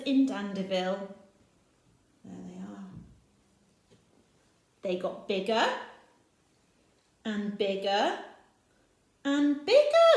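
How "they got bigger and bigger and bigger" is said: put-on growing bigger and bigger for children; building emphasis. A narrator's reading. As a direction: build it up to a big finish. Caterpillars growing, repeated phrase.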